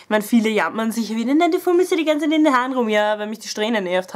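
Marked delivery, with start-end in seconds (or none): 1.3-2.9 nachäffend. Retold or imitated. Mocks what people keep telling her.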